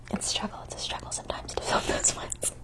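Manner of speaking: soft ASMR whisper. Acted. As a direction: whisper close to the mic.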